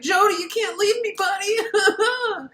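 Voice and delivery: very high mock-crying whine; clingy Michael. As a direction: plead in a squeaky, hammed-up wail.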